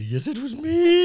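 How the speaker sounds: high, slow hammed-up confession voice; mock Franklin confession. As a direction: squeak it out like a guilty old statesman.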